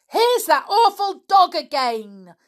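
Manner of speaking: high, loud alarmed yell. Acted. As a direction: yell in panic as the dog leaps. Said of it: "yelled Block".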